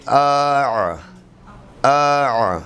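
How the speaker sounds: "آ" closing into a throaty Arabic ain; ain sound. Shown as a demonstration. Teacher demonstrates the ain sound to students, throaty and held. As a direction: squeeze the end of "آ" into ain from mid-throat. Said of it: "یہ عین کی آواز جو نکل رہی ہے".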